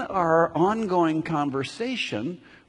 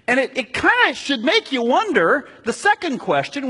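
higher, louder preaching push; fervent preaching; 8 semitones higher, 6 dB louder than usual. Acted. Pastor pressing the congregation on why gratitude matters, in full-force preaching. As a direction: drive the big question home to the pews.

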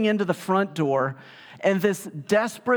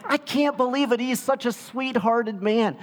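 mock disbelief; 8 semitones higher than usual. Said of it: "And I'm like".

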